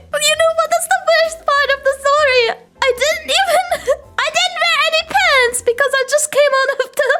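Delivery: high, fast, laughing talk. Real, not acted. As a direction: rush it out through giggles. Laughing through the worst part.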